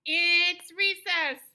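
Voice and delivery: loud, high, drawn-out cheer; exclamation mark in the voice; super excited exclamation. Shown as a demonstration. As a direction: announce it big, stretching the words out. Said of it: "you're super excited".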